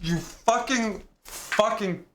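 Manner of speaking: furious drawn-out yell, high and loud; cut-off raging shout; angry shout. Real, not acted. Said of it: "I'm gonna wake people up".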